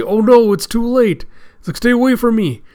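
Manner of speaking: high, loud acted alarm; panicked cry. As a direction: recoil from contagion, crying out. Prisoners panicking over plague.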